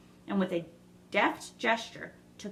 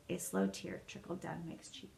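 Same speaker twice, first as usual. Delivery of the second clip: plain reading voice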